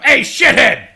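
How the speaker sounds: loud, high yell; rowdy mock-angry hollering; heckler's shout. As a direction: bellow it at someone to get their attention. Acted heckler yelling.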